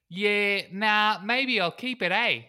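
high, loud, slow mock-Kiwi hobbit; Bilbo. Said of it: "says Bilbo".

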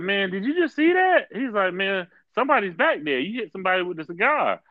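high, excited retold exclamation; surprised friend. Friend T reacting in the car.

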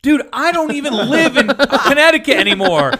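can't believe the call; baffled laughing coworker. Retold or imitated. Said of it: "he's like".

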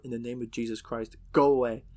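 building to a loud go away; defiant shout at the creature; shouted command. Retold or imitated. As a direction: call it out into the woods. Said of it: "says something to the effect of".

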